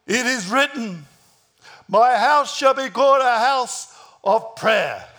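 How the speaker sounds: ringing, commanding announcement; forceful proclamation. Acted. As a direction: proclaim scripture over the uproar. Jesus declaims scripture to the crowd.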